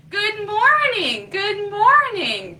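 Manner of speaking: high, loud sing-song morning call; chirpy bird greeting. Acted. Little birds greeting the morning, high cheerful chirp. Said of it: "the little birds say".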